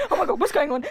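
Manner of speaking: excited dog voice, sniffing. Pretend. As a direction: play a curious dog sniffing. Acting a dog at a tree.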